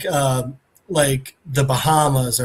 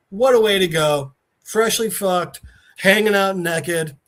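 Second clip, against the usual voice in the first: normal talking voice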